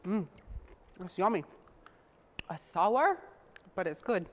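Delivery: talking while chewing; mouth full. Real, not acted. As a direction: speak around a bite. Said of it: "I think I'm gonna steal a piece".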